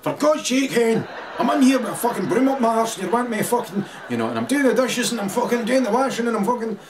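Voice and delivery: high, loud, fast exasperated rant; mock shouting husband. Husband shouting from the kitchen at his whining wife, exasperated yell. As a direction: yell it like a fed-up husband at the sink. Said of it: "shouted at his wife and said".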